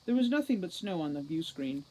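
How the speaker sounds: usual speaking voice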